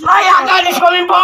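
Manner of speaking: rally shout. Real, not acted. Orator asks crowd, crowd answers.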